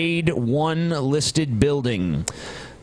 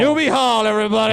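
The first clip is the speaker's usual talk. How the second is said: higher, louder "Newby Hall, everybody"; mock tour announcer; 7 semitones higher, 4 dB louder, much faster than usual. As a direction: announce it like a cheesy tour guide. Hamming up the place name.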